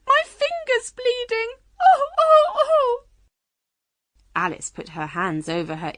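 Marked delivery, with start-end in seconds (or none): 0.1-1.6 high, loud wail of alarm; shouting. A narrator's reading. Queen shouting about her finger, alarmed shout. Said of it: "she shouted". 1.8-3.0 high, loud repeated wails; screaming. A narrator's reading. Queen screaming over her finger, loud wails. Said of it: "began to scream very loudly".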